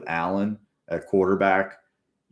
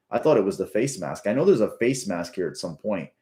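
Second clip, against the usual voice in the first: usual speaking voice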